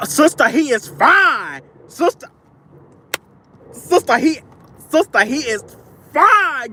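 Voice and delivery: high, loud gush over him. Real, not acted. Telling her sister he is fine, squealing high. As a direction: gush like she can't contain it.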